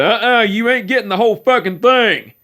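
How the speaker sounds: high, loud gruff scolding; scolding uncle. Retold or imitated. Uncle scolding cousin over cheesecake, gruff loud scold. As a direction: bark at the kid grabbing dessert.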